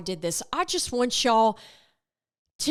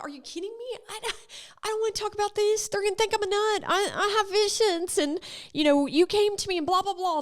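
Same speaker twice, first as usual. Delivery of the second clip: much higher, fast exasperated protest; mock protest; 10 semitones higher, faster than usual. Telling God she won't do it, high flustered whine. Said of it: "sometimes I'm like".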